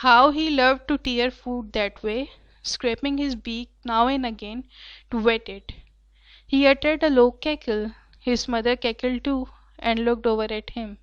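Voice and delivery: reading the passage very slowly and clearly; slow story reading. A narrator's reading. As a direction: read each word out slowly for the class. (Class کے لیے بہت آہستہ پڑھنا)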